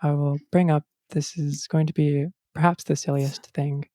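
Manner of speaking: normal talking voice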